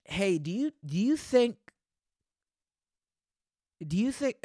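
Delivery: high, airy mock interviewer; mock admissions officer. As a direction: ask it like a clueless officer. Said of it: "do they just be like".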